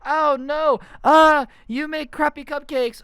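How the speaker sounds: loud, high hammed-up yelling; mock battle cry. Moms swinging on each other, mock combat shouting. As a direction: yell playground insults like an action-fight combatant. Acts out mock mom fight.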